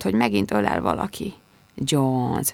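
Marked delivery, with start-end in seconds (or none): 1.7-2.5 gentle whisper. A narrator's reading. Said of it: "súgta gyöngéden".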